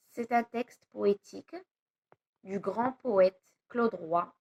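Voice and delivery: normal talking voice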